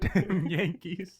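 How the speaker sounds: drawn-out, high Southern jeer; mock Southern accent. Friend playing a Southerner at the Jersey visitor, mock Southern drawl. Plays disgusted Southerner in jest.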